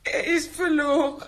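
tearful lament, rising to a sob; crying over the lost one. Acted. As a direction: weep the words out. Wife laments about lost dog.

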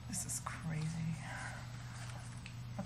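whispered aside; whispering. Acted. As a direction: breathe it under your breath. Aside about the plan.

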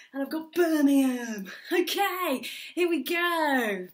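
big, hammed-up recap with punched suggestions; over-eager improv host. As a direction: announce it like a wacky improv compère. Plays improv show host.

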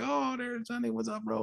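high, soft imitation of welcoming buddies; mock gang greeting. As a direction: greet him like the crowd, high and hushed. Voicing the gang greeting him.

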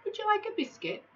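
a high, fast polite offer; lightly voiced polite giant. The giant offers Tom a biscuit with cocoa, lightly polite. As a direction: offer it with mild good manners. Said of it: "asked the giant politely".

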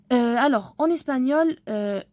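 ordinary delivery

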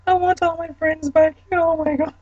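high-pitched giggling retelling of the punchline; squealing repeat. Real, not acted. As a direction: squeak it out, cracking up at your own joke.

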